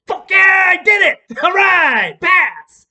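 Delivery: high, loud whoop of victory; triumphant yell. Retold or imitated. Drunk man cheering himself after passing the nose test, loud and high. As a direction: shout it like you just scored.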